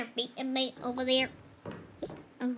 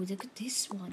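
whispering; 5 semitones lower, 6 dB quieter than usual. Pretend. Hushed find.

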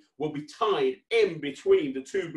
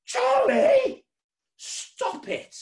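high yell, then a drawn telling-off; mock-outraged scolding; 7 dB louder, much slower than usual. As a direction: pretend shock at the naughty puppet. Catches puppet misbehaving.

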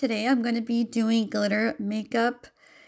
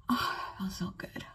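relieved whisper; 5 semitones lower, 9 dB quieter than usual. Real, not acted. Relief as the mask peels.

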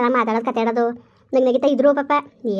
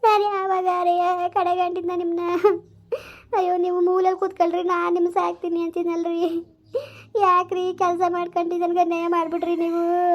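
tearful wailing; 4 semitones higher than usual. Acted. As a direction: cry out the words in grief, reproaching the dead husband. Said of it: "ಸುಮ್ನಿರ, ಅಳ್ಬೇಡ". (ಗೋಳಾಡುತ್ತಾ ಅಳುವ ದನಿ)

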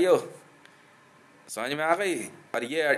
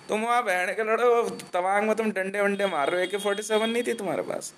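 ordinary talk, nothing marked

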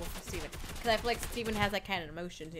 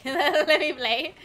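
loud, higher, stretched-out whine after laughing; drawn-out plea; 5 semitones higher, 9 dB louder, much slower than usual. Acted. Streamer acting out wanting to just play, loud drawn-out plea. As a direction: drag the words out loudly. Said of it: "I feel like I'm like".

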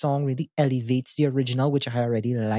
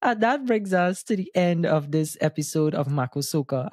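ordinary talk, nothing marked

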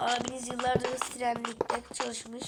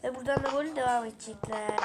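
ordinary talk

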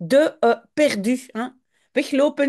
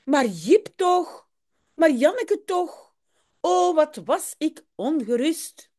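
relieved mother; 8 semitones higher than usual. Acted. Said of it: "Ze zegt".

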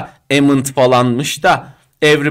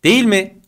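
"Değil mi" pitched high with stress on "Değil"; intoned tag question; 6 semitones higher, 4 dB louder than usual. Shown as a demonstration. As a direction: punch "Değil" high, then let "mi" fall. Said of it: "vurgu ve tonlamayla söylüyorsun". ("Değil mi" yüksek perdeden, vurgulu)

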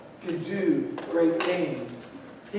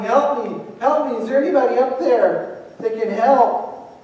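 loud, high cry for help; yelling; 5 semitones higher, 5 dB louder than usual. Retold or imitated. Man on a cliff yelling for rescue, loud and high. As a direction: call up urgently to anyone above. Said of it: "he's yelling".

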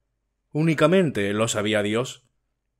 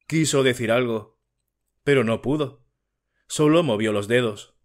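ordinary delivery, nothing marked

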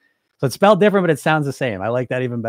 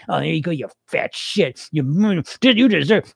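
mean inner voice; 4 semitones higher, 3 dB louder than usual. Retold or imitated. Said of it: "that mean voice you have".

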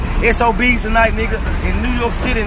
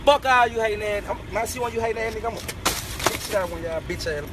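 ordinary talk

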